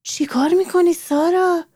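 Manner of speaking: a mother catching her daughter at something odd; lightly voiced curious mom; puzzled mother. A narrator's reading. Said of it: "مادرش گفت".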